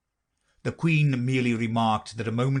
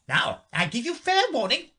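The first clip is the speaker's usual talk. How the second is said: shouting. A narrator's reading. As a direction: shout a warning like a queen. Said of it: "shouted the Queen".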